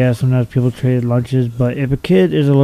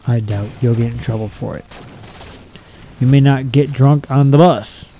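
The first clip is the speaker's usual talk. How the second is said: ordinary talk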